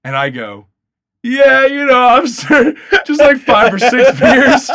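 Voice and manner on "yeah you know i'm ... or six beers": high and loud, laughing through the lie; laughing bluff. Retold or imitated. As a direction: fake casual answer while cracking up.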